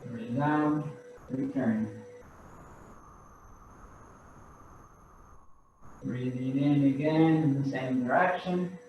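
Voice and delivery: guided breathing cues. Acted. Instructor pacing the class's breaths, soft and calm.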